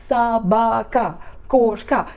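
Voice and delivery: "Собака, кошка" slowly, each syllable separated; syllable-by-syllable demo. Shown as a demonstration. Teacher showing how hard Russian words are, "Собака, кошка" slowly syllable by syllable. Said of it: "has three syllables".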